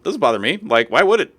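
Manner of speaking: light unbothered reply to stepson; breezy shrug. Retold or imitated. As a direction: shrug it off happily. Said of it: "I'm like".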